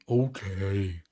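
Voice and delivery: put-on drawn-out agreement; dopey caricature saying yes; Elongated Muskrat. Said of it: "Elongated Muskrat would say".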